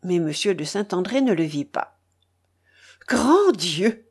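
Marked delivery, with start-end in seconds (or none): 3.1-4.1 bishop crying out, a touch raised; shocked exclamation. A narrator's reading. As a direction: cry out in pious dismay. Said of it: "s'écria l'évêque". (exclamation scandalisée)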